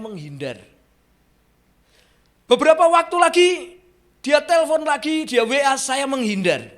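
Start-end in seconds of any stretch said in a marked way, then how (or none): 2.5-6.7 loud, much higher, then easing down; preaching shout. Acted. Preacher tells the congregation how he dodged the calls, shouting then settling. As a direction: call it out, then let it fall.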